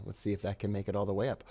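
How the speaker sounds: normal talking voice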